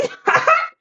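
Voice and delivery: squealing laugh far up in the voice; high burst of laughter. Real, not acted. Streamer laughing at his own oolong pun, high squealing laugh. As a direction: cackle at your own joke.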